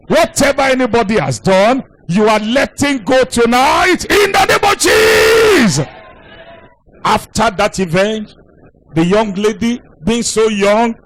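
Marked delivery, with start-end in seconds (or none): 0.1-5.9 shouted declaration. Real, not acted. Preacher releasing the congregation from unforgiveness, climactic shouted declaration.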